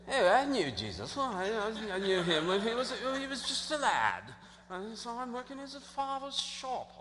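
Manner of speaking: soft put-on villager voice, pitch swooping high; changed townsfolk accent. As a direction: imitate a quaint local reminiscing, quietly and in an affected accent. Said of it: "All right, I'll change that".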